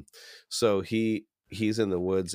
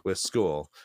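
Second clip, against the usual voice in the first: ordinary talk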